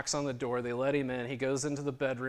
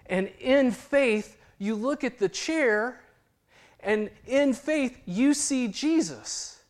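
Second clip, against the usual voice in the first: much higher, louder, slow and drawn out; slow emphatic; 11 semitones higher, 7 dB louder, much slower than usual. Acted. Preacher giving the chair prayer, slow and emphatic. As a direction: lean on every word, slowly. Preacher slowing key phrase.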